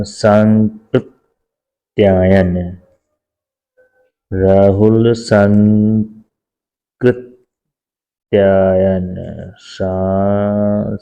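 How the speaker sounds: Sankrityayan drawn out very slowly syllable by syllable; slow careful spelling. Shown as a demonstration. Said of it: "संस्कृत्यायन नहीं". (सांकृत्यायन बहुत धीरे, अक्षर-अक्षर)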